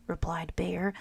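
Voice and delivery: usual speaking voice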